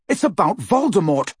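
high, forceful challenge; angry shouting. Acted. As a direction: throw it at her in anger. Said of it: "Harry shouted at her".

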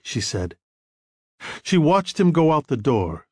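usual speaking voice

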